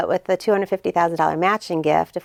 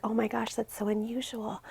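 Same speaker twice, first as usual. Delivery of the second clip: whispered, higher, in quiet wonder; hushed amazement; 4 semitones higher, 12 dB quieter than usual. Retold or imitated. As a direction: gasp it softly like a secret. Trainers' amazed reaction retold.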